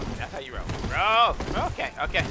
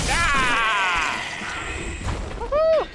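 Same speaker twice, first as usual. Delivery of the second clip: excited whoop; 4 semitones higher, much slower than usual. Real, not acted. Winning a fight.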